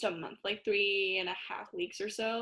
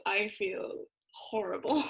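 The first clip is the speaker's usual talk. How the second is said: whispered, slow groggy complaint; groggy groan. Retold or imitated. Waking up jet lagged in London, slow whispered groan. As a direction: breathe it out half asleep and wrecked. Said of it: "I woke up and I'm like".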